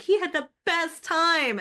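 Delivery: slow, high and loud gushing. Retold or imitated. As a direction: gush over how much fun he had. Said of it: "I was like".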